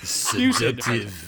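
slow, loud mock French accent. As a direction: draw it out in hammed-up French. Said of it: "Say that in a French accent".

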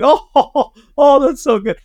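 high, laughing gush over his own take; mock self-admiration. Director gushing at his own take on the monitor, laughing. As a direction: swoon at your own playback, laughing. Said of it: "I'm like".

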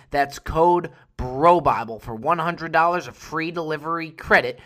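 ordinary talk, nothing marked

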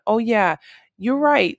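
a bright oh-you're-right moment of dawning; sudden realization. Retold or imitated. Realizing her sister is right.

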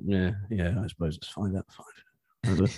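soft, lukewarm mock approval; grudging British understatement. Podcast host imitating a British reviewer's five stars, lukewarm and soft. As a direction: mumble faint praise as if barely convinced. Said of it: "the British guy is just like".

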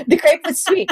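high, giggling words; laughing through words. Real, not acted. Guest answering the host's crepe question while laughing. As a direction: say it while cracking up.

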